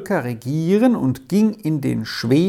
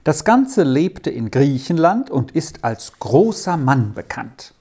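usual speaking voice

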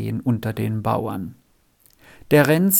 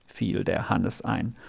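ordinary delivery, nothing marked

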